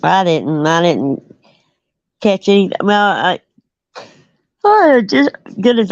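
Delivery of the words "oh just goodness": talking through a yawn; words stretched by a yawn. Real, not acted. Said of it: "I got the yawn".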